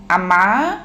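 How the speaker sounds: questioning call, rising at the end; child's searching call; calling out for mother. A narrator's reading. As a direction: call out to your mother, voice swinging up. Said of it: "మళ్ళీ పిలిచాడు".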